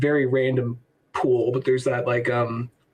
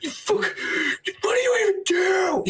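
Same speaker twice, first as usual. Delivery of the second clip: very high, drawn-out cartoon shriek; Pikachu pissed off; slower than usual. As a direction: squeal in speechless mock fury, slowly. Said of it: "he's so pissed off".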